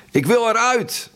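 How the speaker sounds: high, desperate shriek; raw scream. A narrator's reading. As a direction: scream to be let out. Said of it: "Een rauwe, keiharde gil".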